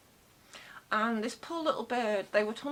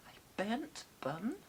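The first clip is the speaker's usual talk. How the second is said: quiet, slow puzzled "A burnt bun?" in another voice; softer mock confused question; mishearing; 6 dB quieter, slower than usual. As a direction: play the listener who misheard.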